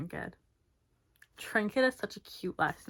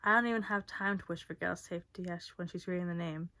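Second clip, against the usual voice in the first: usual speaking voice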